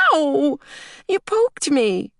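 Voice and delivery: put-on high, drawn-out childish yelp and complaint; hurt little girl. A narrator's reading. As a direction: cry ow, then accuse Daddy. Small girl pricked by pin.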